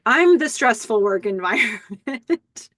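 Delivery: smug laughing quip. Retold or imitated. Billy scoffs, smiling at Teddy.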